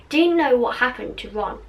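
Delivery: plain reading voice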